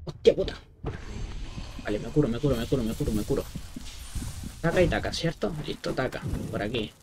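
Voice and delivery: normal talking voice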